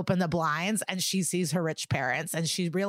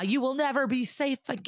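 raised-pitch retold menace; mock threat; 6 semitones higher than usual. Podcaster retells defendant threatening the witness, mock threat. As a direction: imitate a dramatic threat, pitched up. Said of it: "He's threatening her".

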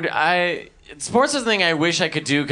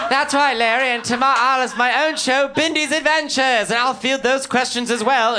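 put-on high, plummy posh-girl TV voice; imperious plummy grande dame; 10 semitones higher than usual. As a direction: lord it over the interviewer, plummy and grand. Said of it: "talking like the Duchess of York".

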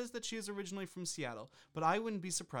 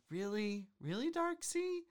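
incredulous; 6 semitones higher than usual. Real, not acted. Mocking reviewer's name.

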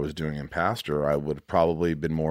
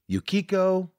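high delighted name; 11 semitones higher than usual. Real, not acted. Savoring a patron's name.

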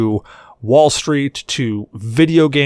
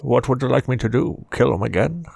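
put-on old Scottish actor voice; Sean Connery. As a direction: keep up the mock star's brogue. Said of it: "that was one hundred percent Sean Connery".

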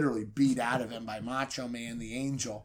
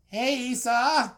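nervous; 11 semitones higher, 7 dB louder, slower than usual. Retold or imitated. Nervous greeting to foe.

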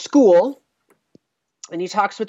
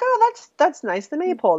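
lightly voiced pleased policeman, pitched up; a hint of cheerful approval; mock pleasant; 11 semitones higher than usual. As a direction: act mildly charmed by the maypole. Howie's mock pleasant reaction.

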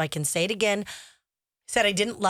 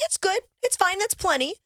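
imitating daughter; 10 semitones higher than usual. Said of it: "she's like".

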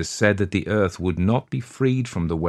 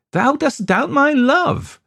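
high, loud, drawn-out reproach; maiden's reproachful outcry; wounded accusation; 5 dB louder than usual. A narrator's reading. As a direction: cry it out slowly, pitch held very high. Lover's quarrel, accusing him.

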